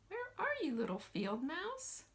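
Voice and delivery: high sing-song calling out. Acted. Teacher calling out to the hidden field mouse, high sing-song. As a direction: call the hidden mouse sweetly.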